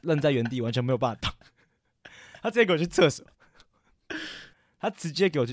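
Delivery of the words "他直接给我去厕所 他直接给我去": exasperated disbelief. Real, not acted. Disbelief at crush going to toilet.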